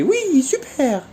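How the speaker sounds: very high, sing-song "oui, c'est bien"; praising the dog. Retold or imitated. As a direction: reward him with a high, bright voice. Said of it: "je le félicitais".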